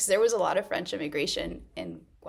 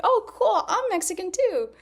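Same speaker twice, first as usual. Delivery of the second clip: high, loud, delighted; naive excitement; 11 semitones higher, 5 dB louder than usual. Retold or imitated. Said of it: "I was just like".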